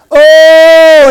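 high, loud drawn-out moan "Oh". Retold or imitated. Bill moaning at the altar, loud and high. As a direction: let out a loud weeping moan. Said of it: "in his loud voice".

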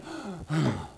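a heavy, fed-up sigh; showing what a frustrated sigh is not. Shown as a demonstration. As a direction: let out an exasperated sigh. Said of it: "He wasn't frustrated saying".

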